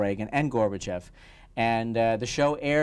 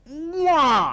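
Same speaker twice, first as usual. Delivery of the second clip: loud smacking mock kiss; kiss sound; 7 dB louder than usual. Retelling the acting coach, a loud mock kiss.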